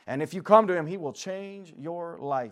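rising preacher cry, slow and heavy; fervent preaching. Acted. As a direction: preach it out, stretching the words. Preacher building to full force.

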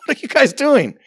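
high-pitched, laughing-at-you question; amused disbelief. Acted. Preacher voices God laughing at the rebels, amused and incredulous. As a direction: ask it as if their effort is laughable. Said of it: "and God laughs. He's like".

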